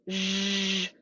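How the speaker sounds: sustained buzzing zh; steady voiced hush. Shown as a demonstration. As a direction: hum through a sh to make zh. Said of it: "it's the voice version of sh".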